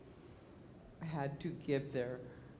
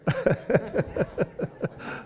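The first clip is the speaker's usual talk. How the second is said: airy silent wheeze of laughter; breathy wheezing laugh; 7 semitones higher, 12 dB louder than usual. Real, not acted. Wheezing with laughter after the car painter story. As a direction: laugh that runs out of voice.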